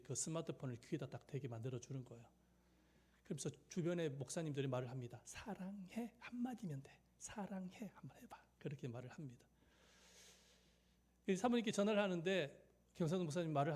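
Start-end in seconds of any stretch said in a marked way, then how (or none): 5.2-8.4 soft, higher coaxing half-whisper; imitating a hushed stage prompt. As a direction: prompt him quietly so the phone won't catch it. Colleagues prompting him during call.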